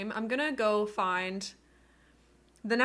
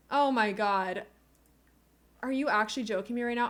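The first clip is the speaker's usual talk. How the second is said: ordinary talk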